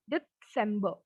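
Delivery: December split with a small T before 'sembuh'; German December, slight T. Shown as a demonstration. Teacher repeats German December to show the slight T. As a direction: say December as 'Det-sembuh'. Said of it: "we have a slight T here".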